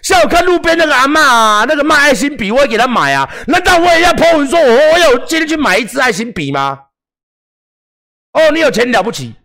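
high, loud mocking shout; sarcastic critic voice. Mocking smug online critics to his viewers, high loud sarcastic shout. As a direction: ham up a smug heckler, sneering.

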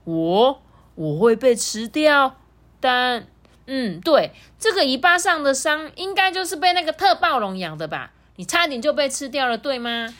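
put-on high, sweet little-kid voice; a small dinosaur child, earnest and innocent; baby triceratops. Baby triceratops talks to T-rex.

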